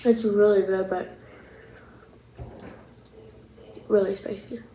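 chewing while talking; words muffled by food; mouth full. Real, not acted. Seen 2: Picks chicken, bites it at 2.7s, chews with full cheeks.

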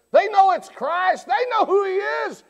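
high, loud proclamation; preacher declares that the stones know Christ, shouting; preaching shout. Real, not acted. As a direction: cry it out at full force. Preaching climax.

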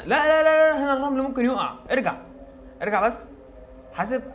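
sharp high alarmed shout; yelling across the room. Real, not acted. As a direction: shout a warning, sand falling. Warning friend sand will fall.

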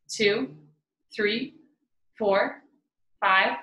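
evenly spaced counting with pauses between numbers; measured meditation count, lilting up on numbers; guided breathing count. Acted. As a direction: pace the listeners' breath, one number at a time.

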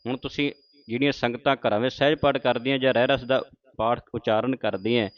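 usual speaking voice

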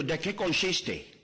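slow, drawn-out gringo Spanish; the American buddy slowly mangling Spanish; American speaking Spanish. Acted. American friend proposes duck hunting, in slow gringo Spanish. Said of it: "Y el americano le dijo".

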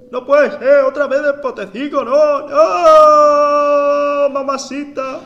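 put-on loud, high Cuban-accented wail of dismay; a hammed-up Cuban accent, crying out in despair. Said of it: "lo he hecho cubano".

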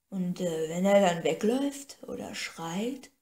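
slow, halting question in a lightly voiced other woman; hesitant servant. Cora unsure about leaving the boy, slow hesitant question. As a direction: drag the words out, unsure what to do. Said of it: "fragte Cora".

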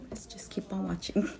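whispering. Real, not acted. Whispered aside to viewers.

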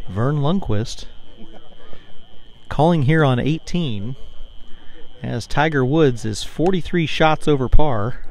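hushed golf announcer voice; soft, low, reverent golf call; golf commentator. Pretend. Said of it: "Use the golf voice".

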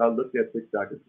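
normal talking voice

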